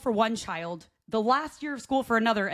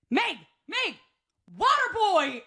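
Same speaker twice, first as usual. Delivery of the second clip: mock calling out; 10 semitones higher, slower than usual. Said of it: "I was like".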